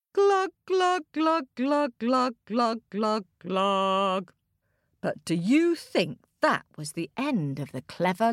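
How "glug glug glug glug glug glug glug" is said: glugging that steps down in pitch; sinking glugs. A narrator's reading. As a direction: sound like bubbles going under water. Rooster sinks in the well.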